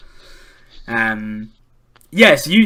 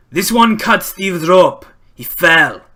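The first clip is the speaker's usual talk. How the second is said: pirate shouting word across; raised, slower call to the crew; 4 semitones higher, 8 dB louder, slower than usual. Acted. Pirate calls out to the other group, calling out. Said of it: "like, call out to the other group".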